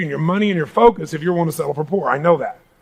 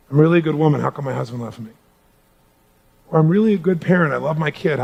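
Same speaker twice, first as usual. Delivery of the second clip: mildly emotional plea; 5 semitones lower than usual. Retold or imitated. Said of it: "they get teary-eyed or emotional".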